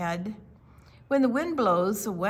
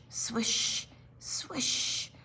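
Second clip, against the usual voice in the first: soft unvoiced swishing; soft rustling swish; whispered swish; 4 semitones higher, 5 dB quieter than usual. A narrator's reading. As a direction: breathe the swish like stalks brushing. Corn stalks swishing.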